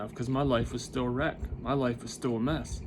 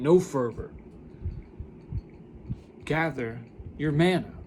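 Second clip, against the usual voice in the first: preaching; 2 semitones higher than usual. Acted. Preaching about gathering manna.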